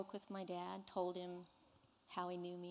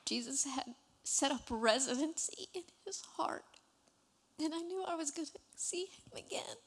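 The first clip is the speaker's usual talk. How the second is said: much higher, breathy, broken by tears; weeping whisper; 8 semitones higher, 5 dB louder than usual. Real, not acted. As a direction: let the voice dissolve into weeping. Emotional climax of testimony.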